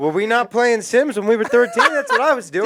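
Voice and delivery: high, loud defensive outburst; protest, laughing and loud; indignant. Real, not acted.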